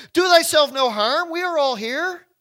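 high, loud call; loud cry. Acted. Paul crying out to the jailer, high and loud. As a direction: cry out to stop him. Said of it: "cried with a loud voice".

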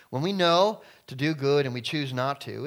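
ordinary delivery

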